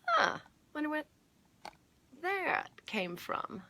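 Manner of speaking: playful wondering. Pretend. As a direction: wonder aloud as if you had no idea. Playfully wonders about the carrot.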